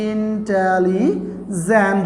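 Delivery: slow, drawn-out syllables in "intelligent"; "intelligent" slowly broken into syllables. Shown as a demonstration. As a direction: stretch out each syllable of "intelligent" to show how many. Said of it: "একাধিক syllable হলে".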